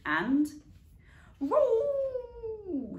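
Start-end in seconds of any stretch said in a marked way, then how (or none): 1.4-3.0 high, loud drawn-out yowl; a stuck cat yowling. A narrator's reading. Stuck cat yowls down from the tree, high and loud. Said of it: "said Scarface Claw".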